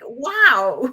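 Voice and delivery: high, loud, drawn-out wonder; surprised. Retold or imitated. Students learning their teacher is the author, amazed. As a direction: imitate awestruck kids. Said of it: "they're always surprised by".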